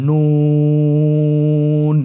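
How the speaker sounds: নুন stretched very long, thin and loud; drawn-out letter name. Shown as a demonstration. Teacher introducing the next letter, long drawn-out নুন. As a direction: hold নুন for three counts. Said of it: "আবার বলুন".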